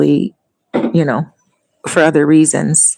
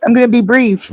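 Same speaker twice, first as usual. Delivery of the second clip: mock protesting promise; 6 semitones higher than usual. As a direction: squeal out a promise to be brief. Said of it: "And then we go".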